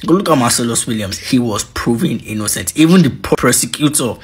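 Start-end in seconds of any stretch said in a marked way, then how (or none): none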